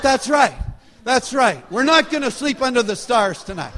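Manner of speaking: loud, high, emphatic agreement; fired-up mock determination; rallied resolve. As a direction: echo the defiance with comic new-found conviction. Rallied by wife's fiery refusal.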